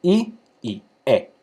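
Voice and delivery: slow, separate i and e; slow vowel-by-vowel demo; vowels named slowly. Shown as a demonstration. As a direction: spell out i and e slowly. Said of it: "tiene dos vocales".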